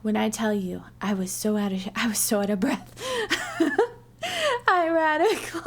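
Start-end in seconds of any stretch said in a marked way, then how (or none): 3.6-5.7 high breathless laughing; real laughter. Real, not acted. Vlogger laughing at how winded she was, real laughter. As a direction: laugh helplessly at yourself.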